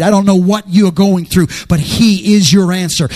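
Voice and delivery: rally the congregation, pushing each line out; preaching at full force. Acted.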